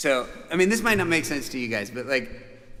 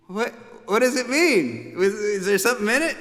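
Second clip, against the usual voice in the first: puzzled; 8 semitones higher, 4 dB louder than usual. Retold or imitated. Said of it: "And I was like".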